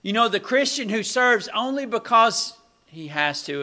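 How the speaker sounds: loud, high, full-force sermon delivery; impassioned preaching. Real, not acted. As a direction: proclaim it to the whole congregation.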